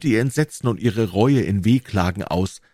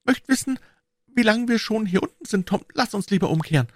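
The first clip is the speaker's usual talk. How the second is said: put-on high, anxious girl's voice; a scared little girl asking to turn back; timid high girl pleading; frightened girl. A narrator's reading. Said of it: "Becky wurde ängstlich".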